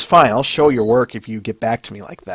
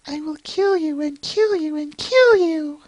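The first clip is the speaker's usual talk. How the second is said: much higher, slow, sing-song threat; slow, much higher mock-menacing cartoon voice; high cartoon menace; 4 dB quieter, slower than usual. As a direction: drag out the threat like a gleeful cartoon villain. Voices a comic character's threat.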